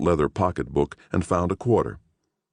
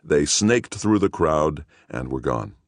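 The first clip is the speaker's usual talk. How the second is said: ordinary delivery, nothing marked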